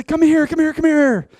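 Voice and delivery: put-on high, loud little-kid calling; a grandchild pulling Papa along; grandkids calling. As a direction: beckon eagerly like a small child.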